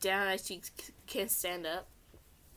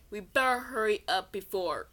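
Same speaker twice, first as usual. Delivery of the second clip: ordinary delivery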